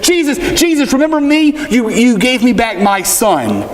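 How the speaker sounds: high, eager call to catch his eye. Retold or imitated. Widow of Nain calls to Jesus, high and eager. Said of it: "she's waving and she was going".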